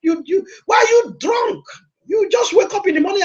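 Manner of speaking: put-on a prophet calling out a woman he thinks is drunk; prophet scolding. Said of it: "the prophet said".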